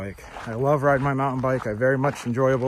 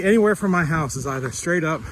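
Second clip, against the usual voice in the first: usual speaking voice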